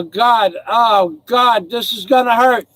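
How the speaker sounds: ordinary talk, nothing marked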